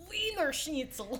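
mock German accent shouting. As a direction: bark it in hammy German accent. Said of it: "Just like your German accent".

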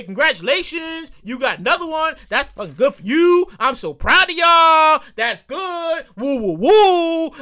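loud, drawn-out fake cheering; mock congratulations. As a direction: congratulate with overdone, insincere pride. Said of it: "I'ma say".

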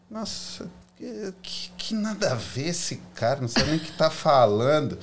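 indignant inner voice. Retold or imitated. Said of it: "fiquei revoltado com ele".

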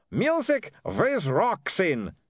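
high, drawn-out vampire cry; yelled in joy. A narrator's reading. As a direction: yell it ecstatically in a vampire accent, stretching the words. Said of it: "he yelled".

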